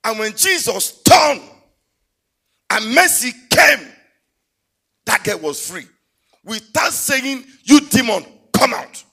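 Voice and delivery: loud, slow, half-sung preaching; high, loud, drawn-out sermon with music; full-force preaching. Acted. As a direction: build to the pulpit climax.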